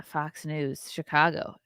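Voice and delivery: ordinary talk, nothing marked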